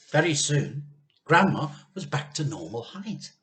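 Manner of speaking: usual speaking voice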